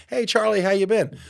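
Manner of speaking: chirpy high voice of a friendly barista; sing-song high greeting; high cheery greeting. Retold or imitated. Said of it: "somebody says".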